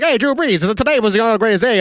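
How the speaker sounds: mock 1920s newsman greeting; hammed-up vintage reporter; imitating Scoops Callahan. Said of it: "he's like".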